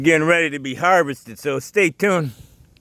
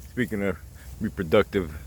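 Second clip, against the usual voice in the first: ordinary talk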